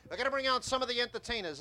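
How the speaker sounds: barker call. Shown as a demonstration. Sideshow talker announcing the acts to gathering crowd, barker style. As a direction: bark it out to draw the crowd in. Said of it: "I'm gonna build a tip like I would normally".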